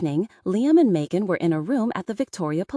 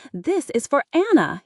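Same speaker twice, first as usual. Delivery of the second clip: loud, higher vengeful yell; 7 semitones higher, 4 dB louder than usual. A narrator's reading. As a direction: fire it out as a battle cry. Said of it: "he yelled".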